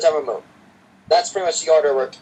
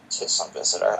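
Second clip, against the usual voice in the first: muttered; 7 semitones lower, 6 dB quieter than usual. Acted. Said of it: "I muttered".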